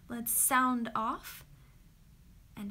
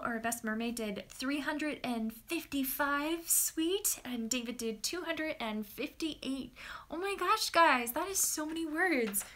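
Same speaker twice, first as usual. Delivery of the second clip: normal talking voice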